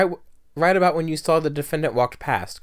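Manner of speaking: ordinary talk, nothing marked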